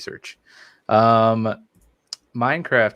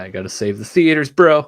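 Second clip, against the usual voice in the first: excited; 5 semitones higher, 10 dB louder than usual. Real, not acted. Hyped about theaters.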